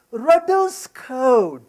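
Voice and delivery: each word slow and deliberate; slow and emphatic. Real, not acted. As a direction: announce it like a headline rule. Announcing a principle, slowly.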